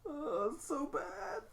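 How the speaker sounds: high, pained cringe; cringing groan. Real, not acted. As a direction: squeal like it hurts. Cringing at bad blurb.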